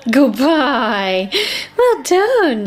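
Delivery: gushing, high-pitched pet praise; delighted dog praise. Real, not acted. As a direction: praise a dog in a big, swooping voice.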